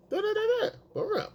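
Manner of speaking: high nonsense babble as her; mimicked girlfriend chatter. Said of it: "high pitched".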